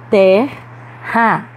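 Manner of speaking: "Terra" split in two, strong first syllable; stressed syllable demo. Shown as a demonstration. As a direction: say "Terra" as Ter... ra, leaning on Ter. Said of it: "há uma sílaba pronunciada com mais força". ("Terra" silabado, com força em Ter)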